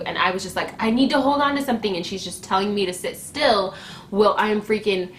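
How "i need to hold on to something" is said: quick, high, pressing plea; urgent plea. Retold or imitated. Labouring woman pleads with nurse for support, urgent quick voice. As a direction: re-enact pleading in a rush.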